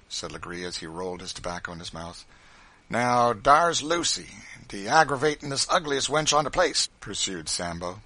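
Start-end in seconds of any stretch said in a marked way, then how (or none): 2.9-6.9 loud, raised Southern dialect voice; aggrieved informer; Sambo's grievance. A narrator's reading. Sambo complains about Lucy.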